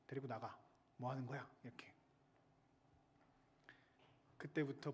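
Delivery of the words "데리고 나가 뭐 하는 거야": ordinary talk, nothing marked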